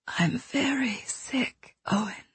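breathy whispered confession, barely there; faint whisper. Acted. Dying woman tells the boy she is sick, whispering. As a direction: whisper it so he must lean in to hear. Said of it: "she whispered".

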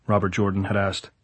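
ordinary delivery

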